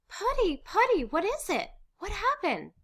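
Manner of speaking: very high, anxious cry; alarmed. Acted. Wife to husband who suddenly sobs, alarmed. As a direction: cry out in startled concern.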